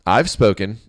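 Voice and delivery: loud royal final word; final commanding king. Acted. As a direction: close the matter like a king. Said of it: "the king finalized".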